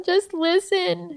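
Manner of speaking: a hint of a daughter asking to be heard; drawn-out, high mock child plea; lightly voiced child. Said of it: "she'll be some".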